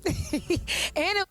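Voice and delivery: high, giggling punchline; laughing reveal. Real, not acted. Laughing as she tells the podcast the belt was fake.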